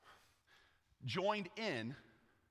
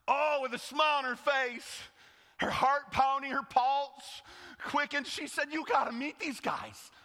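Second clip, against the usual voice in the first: high, loud, breathless delight; girl telling her masters, thrilled; eager; 9 semitones higher, 5 dB louder than usual. Acted. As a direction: rush it out, heart pounding.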